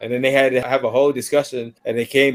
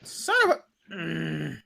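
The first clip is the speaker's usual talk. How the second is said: strangled mock curse; 4 semitones higher, 6 dB quieter, much slower than usual. [name] swearing, cut off.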